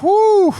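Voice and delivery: loud, high "whoo" of relief; long, high exhaled cry of relief; relieved whoop. Retold or imitated. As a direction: let out a big high whoo as tension releases. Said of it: "A little bit of relief".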